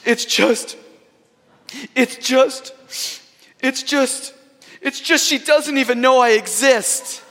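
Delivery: high, halting mock sobs building to a wail; hammed-up high crying voice; imitating tearful outburst. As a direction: act out a heartbroken boy finally blurting it out. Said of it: "trying to get his composure".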